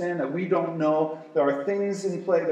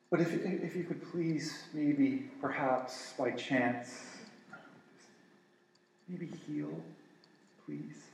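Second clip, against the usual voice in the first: timid; 2 semitones lower, 10 dB quieter than usual. Pretend. Timid prayer acted out.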